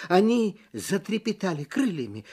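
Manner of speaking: ordinary delivery, nothing marked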